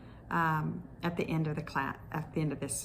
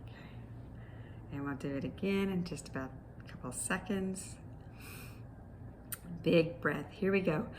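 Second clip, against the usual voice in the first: usual speaking voice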